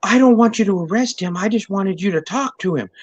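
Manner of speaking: high, whiny mock plea; whiny soy boy. Mocking the husband pleading with the cops, high whine. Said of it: "this guy comes running".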